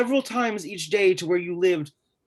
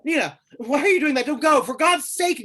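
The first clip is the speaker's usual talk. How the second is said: much higher, louder and fast, frantic; panicked plea; 8 semitones higher, 4 dB louder than usual. Acted. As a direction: beg her frantically not to go. Panicked pleading as she goes.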